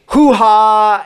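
loud, high drawn-out catchphrase impression; Al Pacino. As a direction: belt it out as a big hammy movie-star whoop. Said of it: "he really is the hoo-ha guy".

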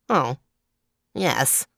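mildly bored clown, unimpressed; indifferent. A narrator's reading. Clown unimpressed by the leopard, mildly indifferent. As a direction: shrug it off with a touch of indifference. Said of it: "said the clown indifferently".

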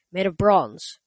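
ordinary delivery

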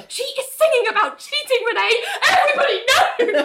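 very high, laughing panic; hammed-up panicking Ali. Ali insisting to Renee the choir song is about her, squealing. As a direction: squeal it like she's mortified.

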